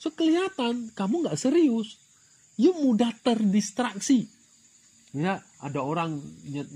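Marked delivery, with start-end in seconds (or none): none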